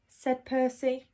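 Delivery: usual speaking voice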